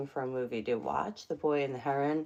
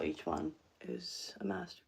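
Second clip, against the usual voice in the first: hushed awe, barely voiced; whisper; 10 dB quieter than usual. Real, not acted. Vlogger admiring art, whispering. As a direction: breathe it out in reverence.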